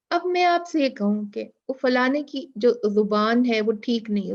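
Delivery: ordinary talk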